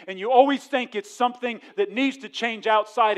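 loud, high, slowed preaching to the crowd; full-force preaching. Real, not acted. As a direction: hammer the warning home from the pulpit.

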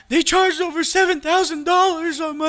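high, shrill mom imitation; panicked mom. Son retelling his mother's panic about the charges, high mom voice. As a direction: pitch way up like his frantic mother. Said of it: "She's like".

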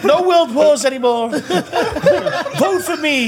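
mock the sugary pageant speech; pageant contestant impression. Mock pageant contestant to the judges, high and sugary. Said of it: "go on and say".

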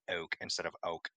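two differing o vowels in "oak instead of oak"; acquired Southern oak set against the old oak; change the vowel between the two oaks; contrasting pronunciations. Shown as a demonstration. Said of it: "I'm more likely to".

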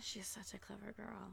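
hushed breathy whisper of the movie line; whispered quote. Pretend. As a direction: whisper it like a secret.

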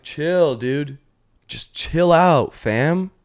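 put-on high, drawn-out teen brush-off; annoyed teenager. Teenage cat fending off clingy owner, exasperated high drawl. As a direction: brush off a clingy parent, stretched out. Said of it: "as they get into their teenage years".

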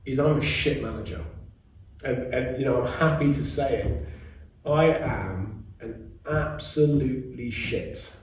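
usual speaking voice

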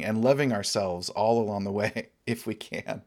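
normal talking voice

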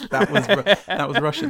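high, loud, helpless laughter through the words; real laughter breaking into speech; laughing. Real, not acted. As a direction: crack up while talking. Cracking up at the accent.

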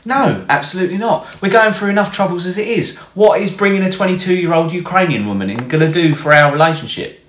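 high, fast indignant wife. Retold or imitated. Wife refuses, high voiced.

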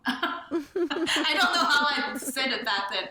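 high, loud talk through laughter; laughing. Real, not acted. Author gives up on redoing her old accent, laughing. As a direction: crack up while admitting defeat.

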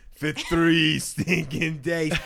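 a hungry orc whining for meat; Lord of the Rings orc. Pretend. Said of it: "It's from Lord of the Rings".

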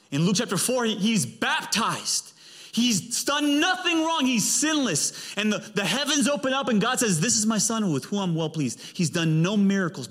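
high-pitched, emphatic preacher; fervent preaching. Real, not acted. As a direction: preach it with rising fervour. Preaches with force.

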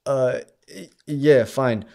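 put-on restrained Austrian-accented request. Husband asking Ted for his wife, Austrian accent. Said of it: "I have to do this motherfucking accent".

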